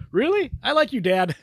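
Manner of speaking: put-on kid voice. Kid voiced in movie recap.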